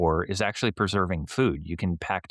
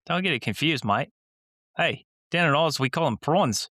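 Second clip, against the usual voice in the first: higher pitched mock Australian; Australian accent; 6 semitones higher than usual. Teasing co-host, higher mock Australian accent. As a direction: play an Aussie bloke. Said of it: "your Australian accent".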